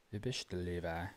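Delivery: imitated Pennsylvania Dutch German accent. Shown as a demonstration. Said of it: "Dutch German accent".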